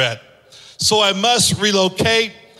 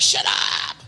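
lower, softer fed-up dismissal; fed-up shut up; 13 semitones lower, 3 dB quieter than usual. Retold or imitated. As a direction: brush off the nagging phone. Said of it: "I'm like".

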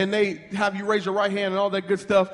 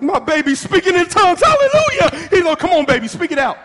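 loud, high church-style whoop; hammed-up hallelujah outburst; mock ecstatic parent; 7 semitones higher, 7 dB louder than usual. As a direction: rejoice at full voice like a revival shouter. Acts thrilled church parent.

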